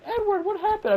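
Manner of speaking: mock-nonchalant falsetto impression; very high, airheaded girlish mimicry; Rebecca. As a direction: mimic a woman far too unbothered, in falsetto. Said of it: "Rebecca reacted very nonchalantly".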